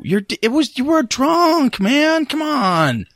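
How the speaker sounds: high, drawn-out exasperation; pleading protest. Acted. Excusing himself for following a drunk friend, high pleading protest. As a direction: whine the excuse at him. Character argues back when accused.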